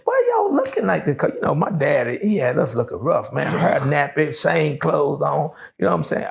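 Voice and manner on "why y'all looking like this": very high, tender with a laugh; sweet high mother greeting her kids; mom's high voice. Retold or imitated. Mom at the phone booth greeting her children, high and laughing. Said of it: "She said".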